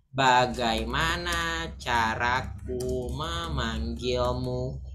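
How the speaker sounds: drawn out slowly, word by word; slow teaching translation. Shown as a demonstration. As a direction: spell out the translation slowly for learners. (pelan, kata demi kata)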